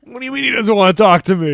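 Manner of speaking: loud, high, fast retort; mock indignant. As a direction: demand an answer in acted outrage. Acting out Sonny's indignant reply.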